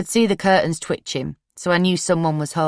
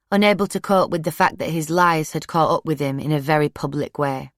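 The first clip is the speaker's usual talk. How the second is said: usual speaking voice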